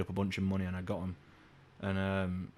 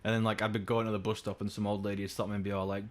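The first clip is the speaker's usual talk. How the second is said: ordinary talk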